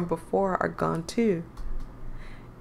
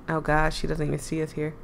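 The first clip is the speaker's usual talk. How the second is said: ordinary talk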